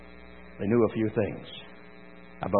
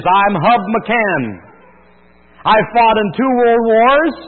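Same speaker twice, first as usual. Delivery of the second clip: higher, louder proud declaration; old warrior's speech; 7 semitones higher, 4 dB louder than usual. Retold or imitated. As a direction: deliver it like a grizzled old soldier putting a punk in his place. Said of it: "here's the quote from the movie".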